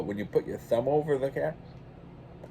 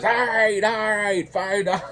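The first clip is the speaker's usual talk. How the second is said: high, loud, exasperated caving in; grudging mad give-in; 12 semitones higher, 7 dB louder than usual. Retold or imitated. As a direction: give in through clenched annoyance, much higher. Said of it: "his face got all red and mad".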